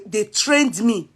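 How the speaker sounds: ordinary delivery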